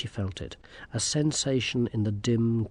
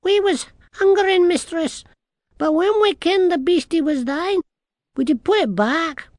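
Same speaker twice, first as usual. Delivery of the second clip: very high, loud little-man voice; nervous tiny Scottish man; 8 dB louder than usual. A narrator's reading. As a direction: pipe up apologetically as a tiny Scot.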